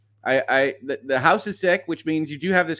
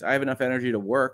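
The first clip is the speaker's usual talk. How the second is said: normal talking voice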